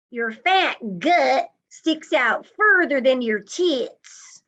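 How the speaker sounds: acted contemptuous taunt, drawn out and forceful; sneering. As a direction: jab each word as a cruel insult. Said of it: "sneering at me and saying".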